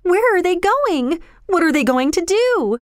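put-on high-pitched, frantic girl's questions; agitated, high voice for the girl; crying out wildly. A narrator's reading. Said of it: "she cried wildly".

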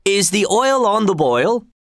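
high, sing-out question; crying out. A narrator's reading. Executioner checking with his helper behind the door, high call. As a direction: call it through to the next room. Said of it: "he cried".

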